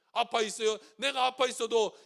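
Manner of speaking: ordinary delivery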